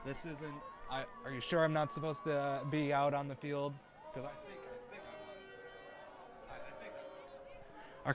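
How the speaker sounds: mock protest under his breath while guards lead him out; hushed plea. Reporter pleading with armed guards as he is pushed out, hushed to a whisper. Being escorted off the field.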